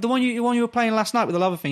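high, rushed pleading; insistent child. Retold or imitated. Child reminding dad about last night's game, high and insistent. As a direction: imitate a child urgently jogging a parent's memory.

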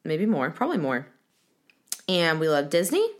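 ordinary talk, nothing marked

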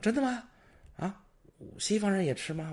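high, incredulous questions; amazed disbelief. Retold or imitated. Mimicking an American friend's amazed high-pitched questions. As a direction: squeak out shocked disbelief.

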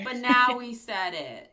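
teasing singsong. Acted. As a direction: stretch it out loud and teasing. Mocking echo of a slip, drawn out.

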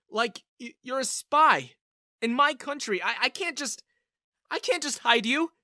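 high, halting, stammering protest; agitated stutter, pitched well up; flustered refusal. A narrator's reading. Flustered refusal to hide a spy.

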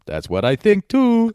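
very high, slow, loud Romanian impression; gleeful mock Romanian builder, booming and high; Romanian accent. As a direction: draw it out in a hammed-up Romanian accent. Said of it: "is that a Romanian accent".

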